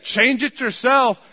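high, loud, slow retort; wife snapping back. Retold or imitated. Wife firing back at her husband about the diaper, mock loud retort. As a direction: fling it back at him, drawn out.

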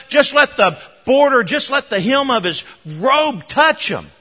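high, loud, urgent calling out; rally everyone to bring the sick, building; excited crowd. Acted. Preacher acting out villagers calling to bring the sick, excited shout.

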